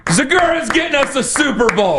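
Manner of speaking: high-pitched hammed-up cheer; mock fan jubilation. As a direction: crow it like an overjoyed fan. Host's mock celebration of guest's news.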